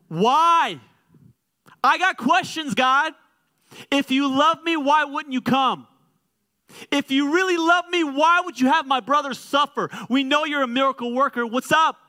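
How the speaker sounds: high, loud pleading why; demanding questions. Pretend. Pastor voicing grief, loudly demanding God explain himself. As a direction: press God hard for answers.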